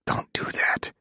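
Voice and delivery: hushed, breathy whisper. Acted. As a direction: whisper it so no one hears. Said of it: "he whispered".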